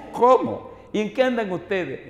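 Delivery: high, loud startled questions; imitated shock, pitch leaping up; mimicked astonishment. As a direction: act out someone's amazed reaction. Retold astonished reaction to his identity.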